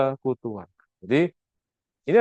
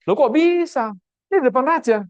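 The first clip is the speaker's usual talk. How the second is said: astonished; 10 semitones higher than usual. Real, not acted. Preacher marvels at Nehemiah's prayer.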